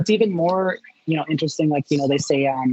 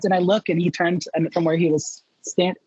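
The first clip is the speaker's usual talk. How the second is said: normal talking voice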